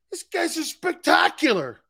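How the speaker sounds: high, emphatic admiration; retold raving. Grandfather praising the visiting players, high emphatic raving.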